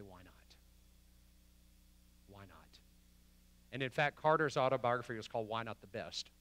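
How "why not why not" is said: very soft, low, searching question; hushed question. Acted. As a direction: ask it almost inaudibly, pausing after each time. Voices Jesus's hushed question.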